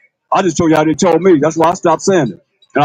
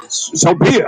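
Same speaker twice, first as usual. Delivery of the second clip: whispered imitation. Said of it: "You heard what I said".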